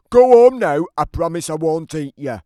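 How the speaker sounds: high, loud order; loud giant command. Acted. Giant telling Jack to leave, loud command. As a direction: boom it like a warning. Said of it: "This means something like".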